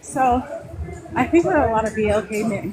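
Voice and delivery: winded after a workout; breathless from the stairmaster; out of breath. Real, not acted. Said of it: "ooh, let me catch my breath".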